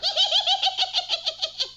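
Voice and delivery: high evil cackle; loud high witch's cackle. Acted. Witch laughs after taking baby.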